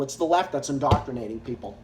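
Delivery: quick sarcastic aside to himself; mock mutter. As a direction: throw the line away under the breath, mocking. Repeats sarcastic claim, muttered.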